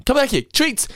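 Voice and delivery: very high, gushing mock generosity; hammed-up guilty coaxing, pitch way up; frantic making up. Guilty owner calling dog back, frantically indulgent.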